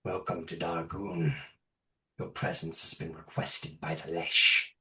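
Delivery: low, soft, grave shaman voice. Acted. Shaman greeting Ludwig on the Lesh's behalf, low and grave. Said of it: "Ludwig, and says".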